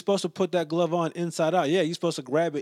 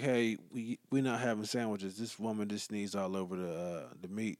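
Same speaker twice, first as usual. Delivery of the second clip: much softer, secretive; hushed phone call; 8 semitones lower, 12 dB quieter than usual. Retold or imitated. Phoning his wife from the store aisle, much softer and hushed. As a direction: keep it down so the deli can't hear. Calling wife about sneezed meat.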